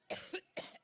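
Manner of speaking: harsh dry cough; coughing fit. Real, not acted. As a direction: cough hard, can't stop. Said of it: "excuse me".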